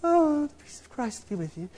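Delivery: soft, hushed, saintly-sweet mock piety; precious holy voice. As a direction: float the words like a too-holy churchgoer. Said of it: "if only I could be like".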